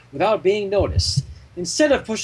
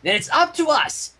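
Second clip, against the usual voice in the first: raised, rallying call; rally the team; determined cry; 9 semitones higher than usual. Acted. Dash rallying the dolls with a determined cry. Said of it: "pointed a determined hoof".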